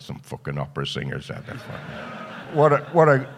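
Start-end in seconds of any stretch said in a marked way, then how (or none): 0.0-1.6 low, soft, fast mutter; quiet fast muttered retort; muttered aside. Retold or imitated. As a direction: grumble it under your breath. Retelling muttered reply to wife.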